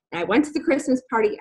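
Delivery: normal talking voice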